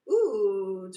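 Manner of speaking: loud delighted ooh; surprised ooh. Real, not acted. Streamer seeing viewer count jump, surprised ooh.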